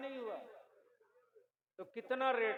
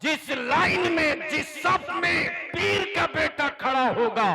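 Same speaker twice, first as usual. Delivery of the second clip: fiery preaching; 7 semitones higher, 11 dB louder than usual. Real, not acted. Cleric preaching against dowry to followers, thundering. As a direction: thunder it to the gathering.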